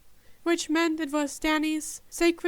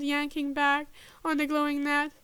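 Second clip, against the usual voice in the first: usual speaking voice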